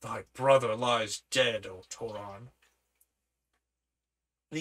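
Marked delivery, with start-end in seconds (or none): 0.0-2.5 low, slow blunt guard; blunt soldier. Acted. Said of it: "brutally interrupted with".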